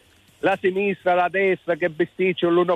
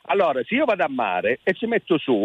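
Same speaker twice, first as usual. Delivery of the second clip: ordinary talk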